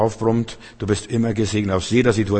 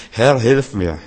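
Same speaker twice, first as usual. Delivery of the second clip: louder, pleading cry to the Lord; urgent plea; 5 dB louder, slower than usual. Acted. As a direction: call out for help as if in need. Preacher voices a desperate plea. (lauter, flehender Hilferuf)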